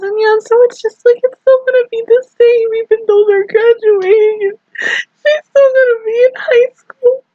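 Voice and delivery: high, wavering voice close to sobbing; tearful gushing. Real, not acted. Fan tearfully gushing about the friends staying together. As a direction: emotion overflowing, barely holding it.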